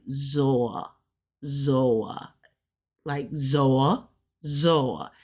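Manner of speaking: "Zoa" said low and slow, run together; native pronunciation. Shown as a demonstration. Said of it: "even with this, it's like".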